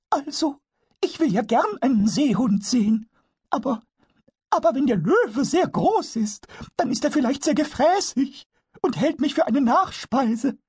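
a tiny goblin fretting about the lion; worried kobold. Acted. Pumuckl tells Eder he fears being eaten, high kobold voice.

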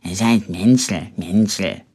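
low, muttered grumble; grumbling. A narrator's reading. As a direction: grumble it under your breath. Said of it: "wie sein Bruder brummte".

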